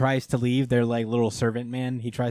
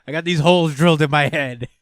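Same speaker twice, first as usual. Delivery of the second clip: louder mock warning voice; scared straight guy; 4 semitones higher, 9 dB louder than usual. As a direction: imitate a scare-them-straight lecturer, loud. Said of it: "he was, like, the scared straight guy".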